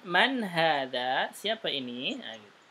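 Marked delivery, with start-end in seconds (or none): 0.0-1.3 slow Man hadha? rising at the end; question tune. Shown as a demonstration. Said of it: "ini baru pertanyaan".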